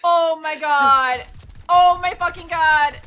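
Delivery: slow, loud, drawn-out disbelief; stunned loud exclaim. Real, not acted. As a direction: stretch each word out in shock. Stunned by in-game event.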